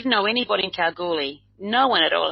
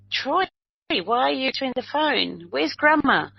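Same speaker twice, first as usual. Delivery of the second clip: high, alarmed questions to her small son; retold surprised calling out; startled mum on the phone; 7 semitones higher than usual. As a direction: sound caught off guard that the child picked up. Mother phoning, child answers.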